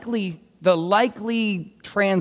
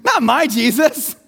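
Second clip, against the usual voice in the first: mock protesting scribe; 3 semitones higher, 4 dB louder than usual. Preacher plays scribe defending his gentle Jesus, mock protesting. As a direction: put on a protesting voice, stretched and raised.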